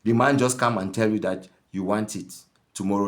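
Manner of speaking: normal talking voice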